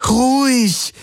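insistent, losing patience. A narrator's reading. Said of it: "flehte Kirai ihn an".

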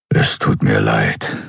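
deep, slow apology; low rumble. A narrator's reading. Kero apologises to weeping Andriel, low rumbling. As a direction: rumble it slowly from the chest. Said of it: "brummte er".

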